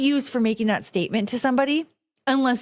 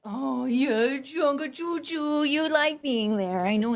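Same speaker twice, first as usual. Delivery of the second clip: higher, slow soft dog-talk; 4 semitones higher, 4 dB quieter, slower than usual. Real, not acted. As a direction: coo at the pup. Cooing to her dogs.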